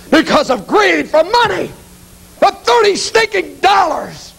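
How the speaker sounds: high, anguished self-reproach; bitter, high-pitched cry; bitter outburst. Acted. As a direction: spit out the self-disgust, slowing at the end. Judas cursing his own greed.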